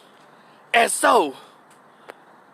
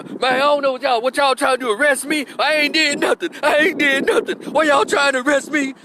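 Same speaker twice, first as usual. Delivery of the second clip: protesting man; 7 semitones higher than usual. Retold or imitated. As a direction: insist you did nothing. Boyfriend protesting arrest, raised voice.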